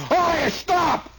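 drawn-out high order to stop; slow high command. Retold or imitated. Cop halting the crowd, slow high shout. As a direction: stretch it out like a cop's order.

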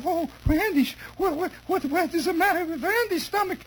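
high, flustered, stammering Hungarian lady; nervous Hungarian lady. Acted. Lady visitor alarmed by boy's bulging stomach, nervous stammer. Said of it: "a very nervous Hungarian lady".

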